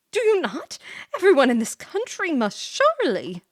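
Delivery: high, loud disbelieving question; incredulous. Acted. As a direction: act shocked they don't know her. Character incredulous at Ash.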